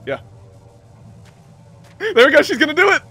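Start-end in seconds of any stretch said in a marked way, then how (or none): 2.0-3.0 excited. Real, not acted. Watching a risky win attempt.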